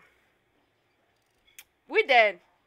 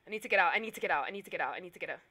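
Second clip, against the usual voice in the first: fading mutter; 7 semitones lower, 9 dB quieter, much faster than usual. Real, not acted. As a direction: lower and softer each time, trailing off. Repeating plea, fading away.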